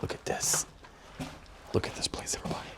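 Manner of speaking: hushed, breathy tunnel whisper. Real, not acted. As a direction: whisper it in awe inside the dark passage. Hushed inside dark fortress tunnel.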